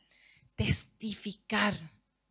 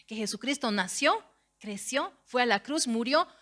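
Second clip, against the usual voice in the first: higher, louder rising sermon voice; preaching; 4 semitones higher, 3 dB louder than usual. Acted. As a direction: drive the good news home to the church. Preaching builds up. (predicación con fervor creciente)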